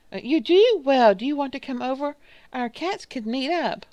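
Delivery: high, loud, over-eager invitation; eager cat lady. Acted. Cat lady invites a new friend over, high and eager.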